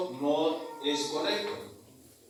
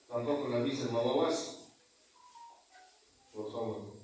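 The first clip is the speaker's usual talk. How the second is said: lower, softer rebuke to the congregation; stern aside; 5 semitones lower, 7 dB quieter than usual. Real, not acted. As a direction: drop the voice to hush the chatterers. Priest scolds chatter during mass.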